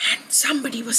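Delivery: soft unvoiced whisper. A narrator's reading. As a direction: whisper so you don't wake it. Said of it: "story scene, sleeping creature".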